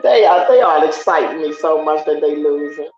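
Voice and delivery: usual speaking voice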